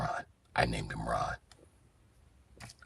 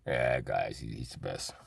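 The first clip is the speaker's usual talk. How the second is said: ordinary delivery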